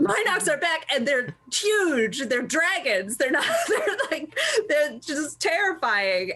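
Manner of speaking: can't contain the thrill of the monsters returning; excited gushing. Real, not acted. Podcaster raving to co-hosts about the Minox, excited and laughing.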